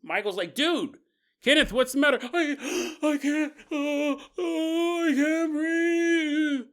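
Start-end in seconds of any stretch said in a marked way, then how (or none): 0.5-2.2 high, loud worried shout; Michael shouting to panicking Kenneth, high and loud; alarmed call. Retold or imitated. 2.2-6.7 high, slow, gasping panic; panicked gasping. Retold or imitated. Kenneth gasping for air.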